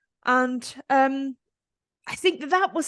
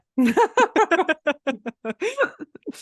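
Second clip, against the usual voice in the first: loud laugh; 7 semitones higher, 5 dB louder than usual. Real, not acted. Laughing at own joke.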